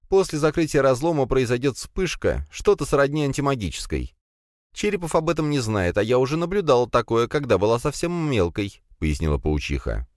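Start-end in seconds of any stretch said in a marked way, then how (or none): 0.1-8.8 put-on higher grumbling young woman; spider girl voice.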